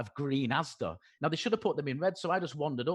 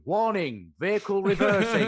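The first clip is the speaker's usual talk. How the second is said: loud, higher robotic warning announcement; truck reversing alarm; 6 semitones higher, 8 dB louder than usual. Retold or imitated. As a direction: call it out like a reversing lorry. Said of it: "I stood there going".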